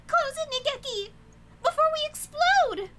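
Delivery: high, loud frantic command; panicked shout. Acted. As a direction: yell it in melodramatic alarm. Character shouts to close window.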